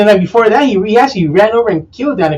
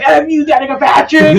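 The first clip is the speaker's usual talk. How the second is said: high put-on Southern shout; mock Southern accent; 8 semitones higher than usual. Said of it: "in that stereotypical Southern accent".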